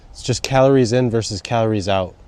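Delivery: usual speaking voice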